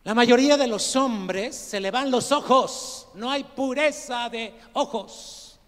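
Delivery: big emphatic pulpit delivery; forceful preaching. Real, not acted. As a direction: drive it home to the whole congregation.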